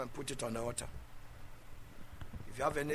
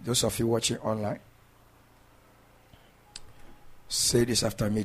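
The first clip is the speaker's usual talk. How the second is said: preaching; 6 dB louder than usual. Acted. Leads online congregation.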